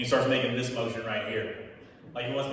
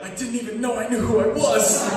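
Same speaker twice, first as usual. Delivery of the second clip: mock outrage; 8 semitones higher, 8 dB louder than usual. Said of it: "And I was like".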